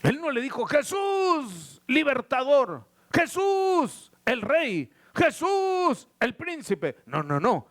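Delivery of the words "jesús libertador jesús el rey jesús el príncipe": high calls on each name, falling back after; crying out. Acted. Preacher acts what the blind man didn't say, calling out. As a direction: cry the name high, then drop for each title. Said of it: "qué se puso a gritar".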